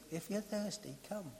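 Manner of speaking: soft, offhand invitation; quiet demo. Shown as a demonstration. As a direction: say it almost under the breath. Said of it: "But slightly louder than that".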